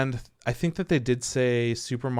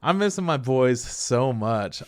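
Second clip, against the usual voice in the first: ordinary talk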